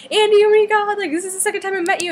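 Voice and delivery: high, loud gushing fan greeting; podekscytowany pisk fanki. Retold or imitated. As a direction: gush at your idol in a high, loud squeal. Fan's excited greeting retold.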